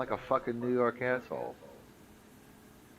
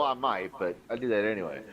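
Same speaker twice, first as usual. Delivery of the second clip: usual speaking voice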